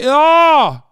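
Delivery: sudden high, loud scream; high-pitched exclamatory holler; loud yell. Real, not acted. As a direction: let out a big drawn-out "ah". Exasperated reaction while reading reviews.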